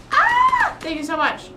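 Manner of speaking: high squeal of delight, then thanks; excited thanks. Retold or imitated. Retelling her thanks to the recruiter, with a high excited squeal. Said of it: "And I was like".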